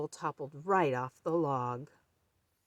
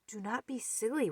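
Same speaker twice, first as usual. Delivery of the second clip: usual speaking voice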